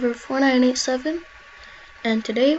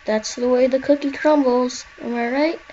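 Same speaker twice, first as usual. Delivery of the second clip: normal talking voice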